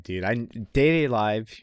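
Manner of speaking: ordinary delivery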